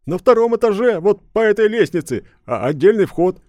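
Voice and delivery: high, loud, eager nervous innkeeper. A narrator's reading. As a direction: gush out a frightened yes.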